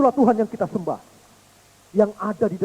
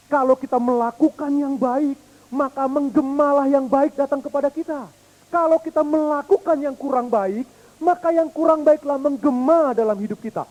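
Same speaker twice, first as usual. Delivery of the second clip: higher, emphatic sermon voice; preaching; 6 semitones higher, 4 dB louder than usual. Acted. Preacher drawing the moral for the congregation, emphatic. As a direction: drive the lesson home.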